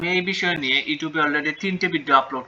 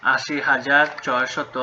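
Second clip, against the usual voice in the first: slow dictation; much slower than usual. Shown as a demonstration. Teacher dictating a number to students, very slowly. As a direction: read the number out very slowly, word by word. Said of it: "teacher reads number aloud".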